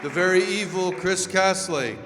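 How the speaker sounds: usual speaking voice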